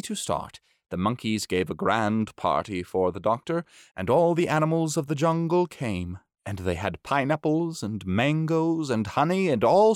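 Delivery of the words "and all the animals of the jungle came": ordinary delivery, nothing marked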